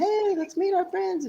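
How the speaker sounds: high-pitched retold greeting to friends; cheerful invitation.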